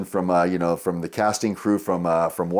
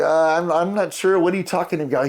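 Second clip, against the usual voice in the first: high-pitched, stammering retold reply; feigned puzzlement; 9 semitones higher than usual. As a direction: play innocent, voice pitched up. Retold own puzzled reply to fan.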